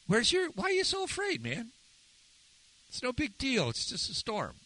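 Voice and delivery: high, soft, easygoing put-on voice; cool, calm Jesus. Preacher voicing Jesus unbothered in the storm, cool and calm. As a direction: brush it off lightly, way up high. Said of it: "Jesus is just cool, calm".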